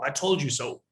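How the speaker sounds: ordinary talk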